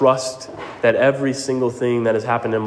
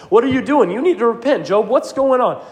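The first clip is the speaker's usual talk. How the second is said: high, pressing voice of Job's friends; accusing friends; 8 semitones higher than usual. Acted. Job's friends scold him about sin, high and pushy. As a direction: needle him like a nagging friend. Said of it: "friends in his life that said".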